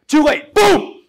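loud, high shouted blast; explosive boom. Retold or imitated. Storyteller imitates the flashbang going off, explosive shout. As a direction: burst it out like an explosion.

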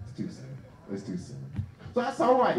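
usual speaking voice

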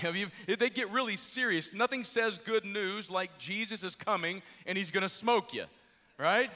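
normal talking voice

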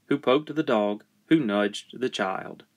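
ordinary delivery

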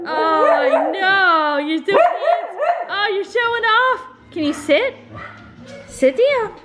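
high, coaxing voice for the dog; dog talk. Real, not acted. High sing-song talk to dog.

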